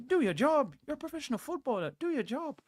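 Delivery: put-on high, thin Irish Roy Keane accent; Roy Keane impression. Streamer doing Roy Keane's Irish accent at his players. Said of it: "That's my Roy Keane accent".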